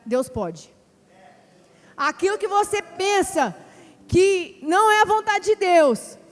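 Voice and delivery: preaching. Acted. Preacher urges the church that God can do it, loud exhortation. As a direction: drive it home to the congregation.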